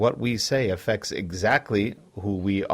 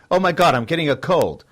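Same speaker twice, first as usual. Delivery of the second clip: sudden alarm, higher and louder; mock panic; 7 semitones higher, 7 dB louder than usual. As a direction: cry out like a hypochondriac. Panicking over a scratchy throat.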